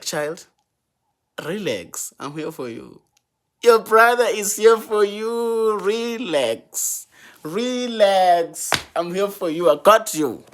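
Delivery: calm her down over the noise; reassuring his sister. Retold or imitated. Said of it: "I was just like".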